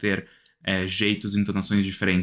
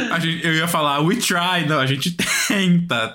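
higher voice breaking into a laugh; amused, laughing; 6 semitones higher than usual. Real, not acted. Podcast host catching himself slipping into English, laughing. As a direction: laugh through a self-correction.

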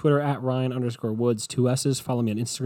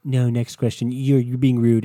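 louder, pushing reporter pressing for an answer; insistent reporter; 7 dB louder than usual. Retold or imitated. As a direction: talk over him, insisting louder and louder. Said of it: "And she goes".

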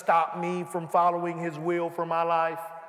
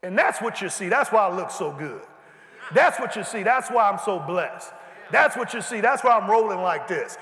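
usual speaking voice

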